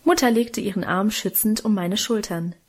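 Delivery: plain reading voice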